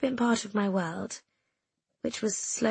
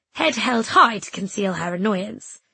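usual speaking voice

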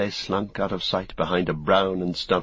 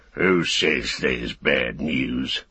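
low, slow, menacing orc roar; shouting orc soldier; 5 semitones lower, much slower than usual. Acted. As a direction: bark it out like an angry brute. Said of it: "shouted the soldier".

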